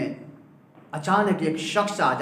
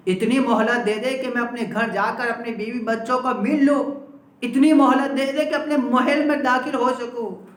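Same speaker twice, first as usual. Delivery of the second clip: mildly fearful begging, rising higher and louder; a shaken king pleading for more time, building; trembling plea; 6 semitones higher, 3 dB louder than usual. Acted. Terrified king begs the angel of death for respite, mildly fearful. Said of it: "اب لرزہ طاری ہو گیا".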